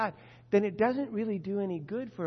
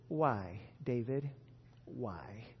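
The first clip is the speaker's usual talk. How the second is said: low, soft, disappointed sigh of a question; weary sighing Lord; 8 semitones lower, 9 dB quieter, much slower than usual. Retold or imitated. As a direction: sigh out the question like a let-down father. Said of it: "the Lord sighs deeply".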